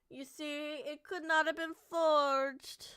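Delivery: slow voiced line, lightly put-on British accent, stress on not; a mild suave British prosecutor, not pressed hard; Edgeworth British accent. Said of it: "my Edgeworth voice".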